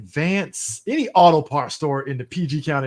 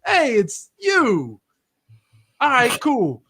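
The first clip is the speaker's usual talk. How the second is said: recognising moviegoer; 8 semitones higher, 4 dB louder, much slower than usual. Retold or imitated.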